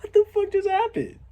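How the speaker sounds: very high-pitched, squeaky disbelief; hammed-up falsetto bewilderment; bewildered shock. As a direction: squeal the question in astonishment. Acts his bewildered reaction.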